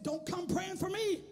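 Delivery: high, loud burst of refusal; emphatic pulpit shout. Acted. Preacher playing someone who doubts prayer for the sick, emphatic shout. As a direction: throw it out as a shouted challenge.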